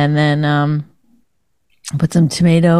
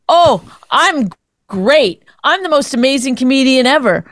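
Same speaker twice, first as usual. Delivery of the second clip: mock bragging; 4 semitones higher than usual. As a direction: draw out the boast, mocking him. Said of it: "That is bragging when you".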